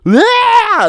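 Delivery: very high, loud shriek imitating a fallen old woman; mock high-pitched scream of pain; old-woman yell. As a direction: shriek like an old lady who just fell. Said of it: "like a fucking ninety-year-old woman who just fell".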